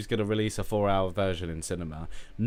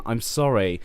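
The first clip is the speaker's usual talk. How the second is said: usual speaking voice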